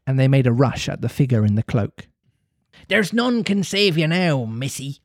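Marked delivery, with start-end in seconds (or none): 2.9-5.0 jeering hiss. A narrator's reading. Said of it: "Mullins hissed jeeringly".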